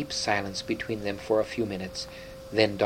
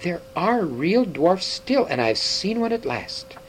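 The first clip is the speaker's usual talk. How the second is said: delighted; 6 semitones higher, 3 dB louder, slower than usual. A narrator's reading. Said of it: "The other was sheer delight".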